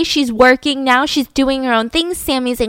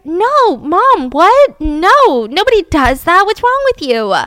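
loud, high, disbelieving protest; outraged shout; 7 semitones higher, 5 dB louder, slower than usual. Retold or imitated. Teen girl refusing her mother's creepy demand, shouting in outrage. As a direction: yell it back at her, appalled. Daughter refuses mom's pubic hair request.

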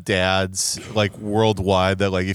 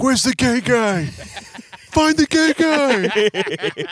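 high, very slow searching dad voice; imitating dad; much slower than usual. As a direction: drawl it high like a lost old man. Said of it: "He's like".

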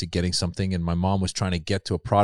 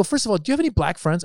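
higher, challenging question to a friend; pointed question; 9 semitones higher, faster than usual. Retold or imitated. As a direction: press the friend with a sharp question.

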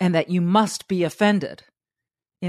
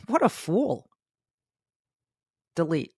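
dismissive scoff; 4 dB quieter than usual. Retold or imitated.

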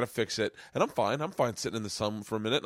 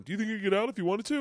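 put-on higher, fast, taunting little kid; mocking kid voice; 8 semitones higher, much faster than usual. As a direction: rattle it off like a teasing kid. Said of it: "thinking of that kid going".

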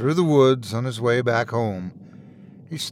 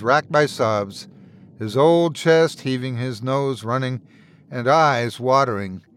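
ordinary delivery, nothing marked

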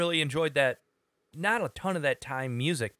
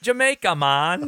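put-on high, loud Jamaican accent; 5 semitones higher, 8 dB louder than usual. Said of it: "that's your Jamaican accent".

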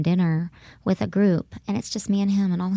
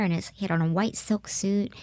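ordinary talk, nothing marked

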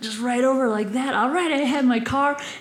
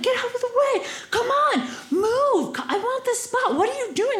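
high-pitched retold yell, no real volume; mock shouting; 12 semitones higher than usual. Truck driver orders her out of the spot, mock shouting. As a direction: imitate an irate driver yelling from his window. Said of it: "he's just shouting at me".

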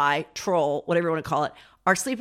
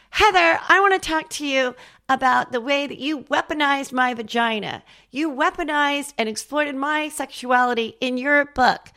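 higher, put-on voice full of wounded outrage; mock indignant Whitney; 9 semitones higher than usual. Whitney confronts Heather about her book, mock indignant. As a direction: play the offended friend confronting her. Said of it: "Whitney was like".

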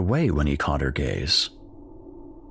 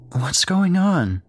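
high, slow, bewildered question; startled boy; 11 semitones higher, 3 dB louder, slower than usual. Acted. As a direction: ask it high and slow, confused. Jake confused, woken.

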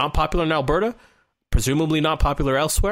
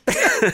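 short laugh. Real, not acted. Host laughs at his own refusal.

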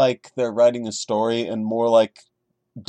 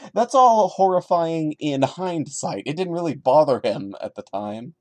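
ordinary talk

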